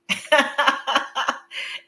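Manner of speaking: airy high laugh, no voice; relieved breathy laugh. Real, not acted. Laughs again.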